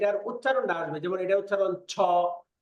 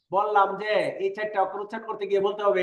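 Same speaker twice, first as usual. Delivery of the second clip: ordinary delivery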